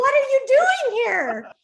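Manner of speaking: high, slow astonished question; retold surprise. Teller recalling finding her friend outside, astonished. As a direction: act out being caught off guard.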